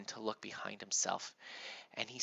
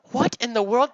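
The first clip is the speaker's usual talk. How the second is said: startled mother; 12 semitones higher, 14 dB louder than usual. Pretend.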